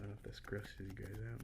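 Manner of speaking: soft unvoiced whisper; ASMR whisper. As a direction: breathe the words close to the mic.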